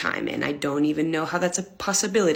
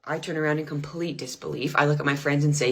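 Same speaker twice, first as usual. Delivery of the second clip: ordinary delivery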